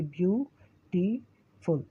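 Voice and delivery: ब्यूटीफुल said slowly, pausing between its three syllables; syllables spelled out. Shown as a demonstration. Teacher to students, ब्यूटीफुल split slowly into three syllables. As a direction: break ब्यूटीफुल into three slow beats. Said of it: "इसमें तीन stops लगते हैं".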